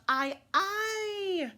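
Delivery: high, drawn-out pirate cheer; crew shout. A narrator's reading. Pirate crew hailing their shipmates, shouting. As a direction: call out a rousing aye aye. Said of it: "as they shout".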